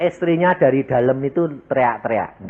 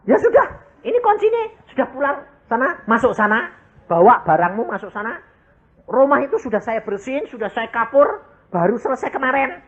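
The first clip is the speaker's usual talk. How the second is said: high, eager landlord; landlord's voice; 11 semitones higher than usual. Retold or imitated. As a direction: urge him in, pitched up. Landlord hands over house.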